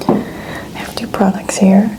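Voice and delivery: ordinary delivery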